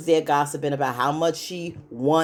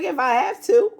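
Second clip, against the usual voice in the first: much higher put-on voice, imitating a sassy retort; sassy comeback; 11 semitones higher, 4 dB louder than usual. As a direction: toss it off high and cocky, mock attitude. Fatima's sassy retold reply.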